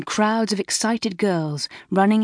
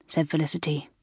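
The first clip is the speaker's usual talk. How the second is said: ordinary delivery, nothing marked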